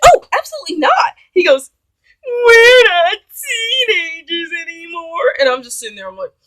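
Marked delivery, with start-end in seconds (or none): 0.0-1.2 very high, loud mock outrage; indignant refusal. Woman refusing date outright, high loud indignation. 2.2-5.3 high, loud, drawn-out wail; mock him whining as if near tears; whiny mock protest. Date protesting her refusal, wailing mock whine.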